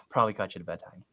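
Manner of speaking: ordinary talk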